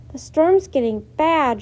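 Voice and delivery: loud call over the storm; shouted. A narrator's reading. Girl shouting to her brother over the storm, loud. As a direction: raise the voice against the wind. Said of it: "shouted Annie".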